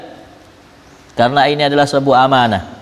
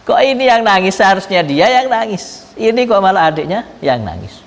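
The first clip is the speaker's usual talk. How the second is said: astonished; 7 semitones higher than usual. Retold or imitated. Messenger baffled that the poor brother weeps, high disbelief. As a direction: retell the man's disbelief, pitched up. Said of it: "tambah heran orang ini".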